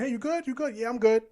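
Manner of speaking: high, light, overly casual greeting; mock cheery greeting; breezy small talk. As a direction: toss it off like a passing hello. Acting out door-holding small talk.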